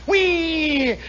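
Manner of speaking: high falling engine whine; screaming plane. Retold or imitated. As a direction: squeal like a racer rounding the pylon. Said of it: "went screaming around this thing".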